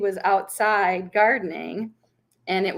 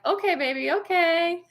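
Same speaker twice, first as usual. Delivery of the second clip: put-on higher-pitched mother placating a toddler; indulgent mother; 10 semitones higher than usual. Mother brushes off her toddler's announcement, imitated indulgent voice. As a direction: humor the little one, pitched up.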